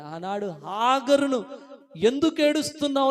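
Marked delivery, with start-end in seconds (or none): 2.0-3.1 high, loud preacher's cry; declaimed question. Acted. God questions weeping Hagar, loud declaimed question. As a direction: throw the question out to the whole congregation.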